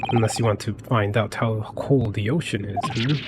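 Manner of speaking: lightly put-on Russian crewman accent; menacing sailor with a mild Russian accent; a hint of a Russian accent, accusing. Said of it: "They had Russian accents".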